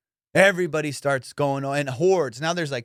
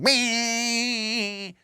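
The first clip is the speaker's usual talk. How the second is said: washer guy noise; 9 semitones higher than usual. Retold or imitated. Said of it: "That motherfucker's ... going".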